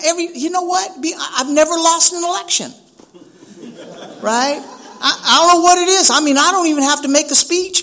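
high-pitched comic boasting; hammed-up humble brag; mock bragging. Acts boastful office holder in argument.

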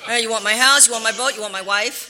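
loud, expansive offering; generous husband. Retold or imitated. Husband offering his house and boat to friends, loud. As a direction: boom it out like a big-hearted host.

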